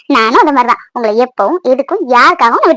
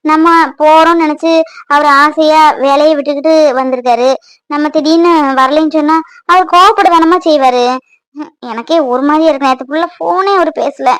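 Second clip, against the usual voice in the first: normal talking voice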